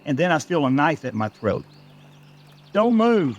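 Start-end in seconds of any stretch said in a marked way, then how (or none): 2.7-3.4 higher-pitched urgent command; sharp threat. A narrator's reading. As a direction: order a captive to freeze, high and urgent. German attacker threatening with a knife.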